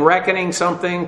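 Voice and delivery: usual speaking voice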